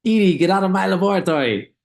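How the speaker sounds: high, loud cartoon boy-genius shout; hammed-up Dexter yelling at his sister; Dexter impression. Quoting Dexter ordering Edie out of his laboratory, loud. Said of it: "he would always say".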